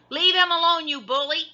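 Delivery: put-on high, loud girl's shriek. A narrator's reading. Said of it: "she shrieked".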